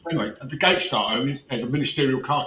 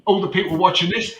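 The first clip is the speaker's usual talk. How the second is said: heated rhetorical outburst; 5 semitones higher, 6 dB louder than usual. Real, not acted. Indignant rant to viewers.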